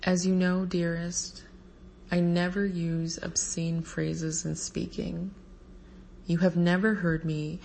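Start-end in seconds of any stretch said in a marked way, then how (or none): none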